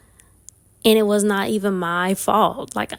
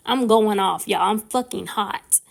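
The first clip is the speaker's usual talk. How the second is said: ordinary talk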